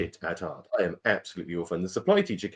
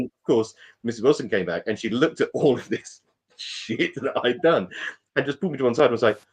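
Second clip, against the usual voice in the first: ordinary delivery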